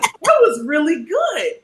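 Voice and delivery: high, slow admiring voice; imitated impressed producers. Producers praise her pitch, imitated as slow, high admiration.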